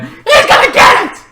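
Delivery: rising to a high shout; vengeful shout. Retold or imitated. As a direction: yell it like pure vengeance. Vengeful character's resolve.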